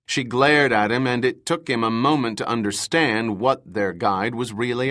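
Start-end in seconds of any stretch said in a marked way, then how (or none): none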